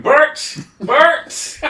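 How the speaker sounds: high, loud screeched call; piercing mock animal cry; screaming bat. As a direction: screech it like a bat, rising each time. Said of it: "I'll be screaming".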